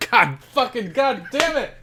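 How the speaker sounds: high, strained cursing with a laugh breaking in; exasperated outburst. Real, not acted. Host realizing the co-host's skate joke, exasperated outburst. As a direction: curse as the prank dawns on you. Caught out on missed game, curses.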